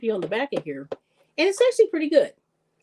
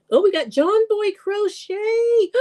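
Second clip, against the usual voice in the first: excited; 13 semitones higher, slower than usual. Real, not acted. Spots a long-missed viewer.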